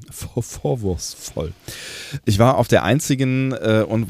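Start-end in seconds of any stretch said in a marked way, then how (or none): 0.1-1.5 langsam und leise gelallt; lallend. Pretend. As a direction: wie betrunken lallen. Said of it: "dass ich lalle". (gespielt betrunken lallen)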